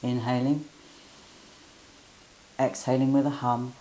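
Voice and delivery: calm guiding voice with hums; guided breathing. Acted. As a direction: lead the humming breath gently. Leads humming bee breath.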